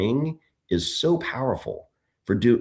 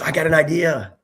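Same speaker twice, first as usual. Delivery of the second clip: lightly voiced little boy, a bit higher; eager little boy; 5 semitones higher, 4 dB louder than usual. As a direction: play the excited kid with the big idea. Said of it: "He goes".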